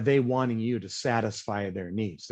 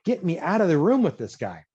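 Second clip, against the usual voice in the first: higher-pitched imitation of a fed-up client; mock exasperation; 7 semitones higher than usual. Analytical client fed up with a fast talker, mock exasperation. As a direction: beg for rescue in hammed-up annoyance. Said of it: "their eyes are gonna roll in the back of their head".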